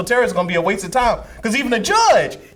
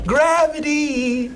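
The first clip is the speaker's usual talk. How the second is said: slow, sing-song "Gravity"; drawn-out gravity; 8 semitones higher, much slower than usual. Real, not acted. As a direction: stretch "Gravity" out very slowly, pitched up. Drawn-out joke word.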